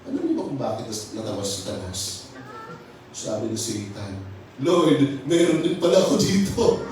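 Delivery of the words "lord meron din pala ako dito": laughing through the line, a little louder; gloating laugh. Retold or imitated. Satan gloating to Jesus while laughing. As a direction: chuckle it out as a smug devil.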